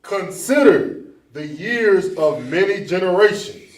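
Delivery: loud, slow scripture call-out; loud reading. Real, not acted. Member reading a scripture aloud to the group, loud and slow. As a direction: read it out loud and slow to the room.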